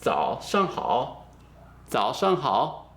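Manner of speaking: "Zao shang hao" syllable by syllable, low "zao"; measured teaching "zao shang hao"; full phrase demo. Shown as a demonstration. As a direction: model "zao shang hao" slowly for learners. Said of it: "say the full sentence".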